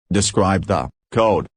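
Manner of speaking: ordinary delivery, nothing marked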